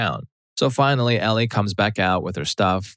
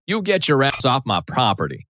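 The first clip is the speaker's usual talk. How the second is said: a hammed-up furious Southern mother; exploding mother; 6 semitones higher than usual. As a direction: bark it like an enraged mother. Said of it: "M, explosion".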